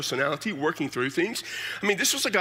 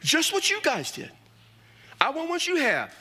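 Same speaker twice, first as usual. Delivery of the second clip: much higher, pleading challenge; Thomas arguing; 8 semitones higher than usual. Retold or imitated. Thomas challenging the disciples, much higher and pleading. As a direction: throw it back at the others. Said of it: "He says".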